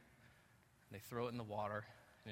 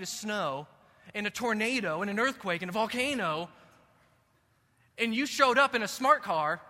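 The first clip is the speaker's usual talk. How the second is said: louder and higher, building to a shouted climax; full-force preaching; 7 semitones higher, 12 dB louder than usual. Acted. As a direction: preach it, climbing with every hazard.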